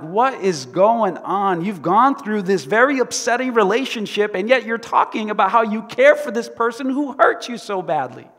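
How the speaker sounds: loud, high disbelief; incredulous. Retold or imitated. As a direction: ask it like you can't believe it. Preacher acts his own disbelief.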